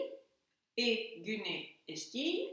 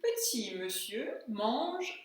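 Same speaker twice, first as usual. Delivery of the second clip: slow dictation; much slower than usual. Shown as a demonstration. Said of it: "Traduisez-moi maintenant la phrase".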